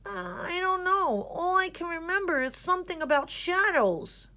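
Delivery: lightly voiced fretful boy; groundhog voice. A narrator's reading. Gregory worried.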